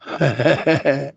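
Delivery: short burst of loud chuckles; real laughter. Real, not acted. Streamer chuckling at the church bells, laughing. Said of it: "I'm laughing".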